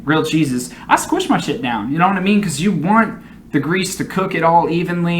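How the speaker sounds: ordinary talk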